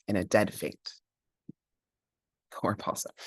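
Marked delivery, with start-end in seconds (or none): 2.5-3.1 pitying whisper. A narrator's reading. Pity for Possum.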